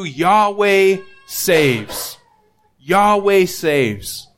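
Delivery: Yahweh saves drawn out slowly, each word set apart; a preacher savouring the name's meaning; slow emphasis. Shown as a demonstration. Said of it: "has the meaning through the Hebrew".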